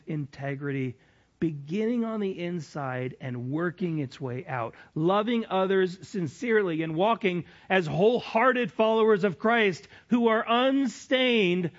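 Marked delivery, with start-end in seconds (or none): 4.9-11.7 louder, higher fervent preaching. Acted. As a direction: build to a fervent close. Preaching climax.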